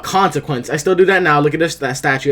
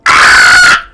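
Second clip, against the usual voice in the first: long, full-force scream; sustained loud "ah" shriek; loud imitated scream; 9 dB louder than usual. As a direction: re-enact screaming at the top of the voice. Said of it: "I start screaming hard as fuck".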